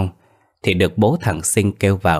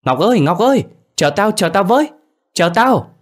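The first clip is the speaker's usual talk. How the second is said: high, loud panicked shriek; shrill, frantic hollering at the top of the range; frightened wailing yell; 12 semitones higher, 4 dB louder than usual. A narrator's reading. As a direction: scream after a friend to wait, terrified. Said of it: "mà la lên oai oái".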